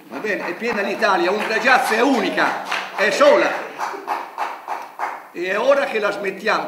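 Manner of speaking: furious shouting, voice rising; explosive italian cursing. Real, not acted. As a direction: explodes in real outrage, loud. Furious protest at press conference. (sfogo furioso, urlando)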